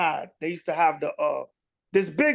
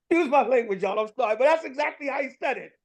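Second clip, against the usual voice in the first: normal talking voice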